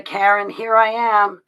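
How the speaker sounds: loud, high, drawn-out greeting; calling out. Real, not acted. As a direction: announce yourself brightly to someone looking for you. Calling out to a viewer.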